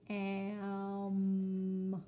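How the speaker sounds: loud, stretched am; drawn-out am. Shown as a demonstration. Teacher sounding out the word ending, loud and slow. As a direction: hold the m in am. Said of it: "We're gonna write ham".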